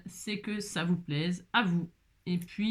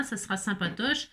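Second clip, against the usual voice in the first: usual speaking voice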